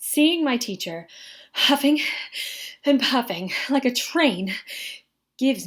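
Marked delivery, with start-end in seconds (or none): none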